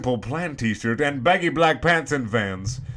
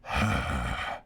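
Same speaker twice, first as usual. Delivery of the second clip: long low exasperated sigh; deep, soft, fed-up sigh; weary sigh; 12 semitones lower, 7 dB quieter than usual. Real, not acted. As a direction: sigh like you can't take another chapter. Reader tired of the text.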